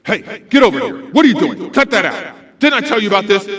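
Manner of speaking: loud, sharp telling-off; scolding daytime voice. Shown as a demonstration. Adult to misbehaving student, loud scolding. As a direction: call the student out forcefully. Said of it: "That's your daytime D J voice for something negative".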